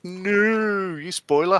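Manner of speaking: high, drawn-out no; hammed-up complaint at a spoiler; mock protest. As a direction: stretch the no in playful dismay. Voices a viewer's mock protest.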